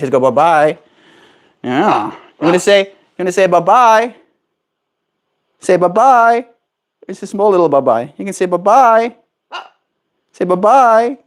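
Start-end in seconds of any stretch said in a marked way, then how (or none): 2.1-11.2 higher sing-song bye-bye; coaxing pet talk. Real, not acted. Owner urging parrot to say bye-bye, coaxing. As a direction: coax the bird to say bye-bye.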